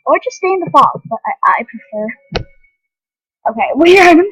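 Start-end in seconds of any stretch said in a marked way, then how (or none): 3.5-4.2 laughing. Real, not acted. Amused at the game.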